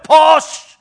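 loud urgent birth coach; coach shouting. Acted. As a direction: yell encouragement at the bedside. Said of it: "You got someone at the side coaching you".

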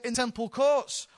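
ordinary talk, nothing marked

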